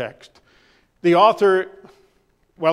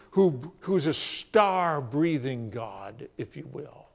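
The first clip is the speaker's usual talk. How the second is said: awed hush; 4 semitones lower, 7 dB quieter, slower than usual. Real, not acted. Awed sermon climax.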